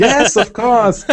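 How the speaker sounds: high, loud, drawn-out fake cheer; over-sweet pretend agreement; mock enthusiasm. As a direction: gush slowly with exaggerated politeness. Mock-polite reply to a player.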